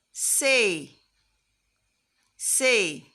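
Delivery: "say" as the French "cé", loud. Shown as a demonstration. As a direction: call out "Say." the French way. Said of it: "but in French, we sound it as".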